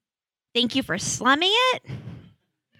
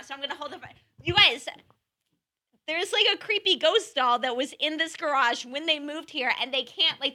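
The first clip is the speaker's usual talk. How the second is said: normal talking voice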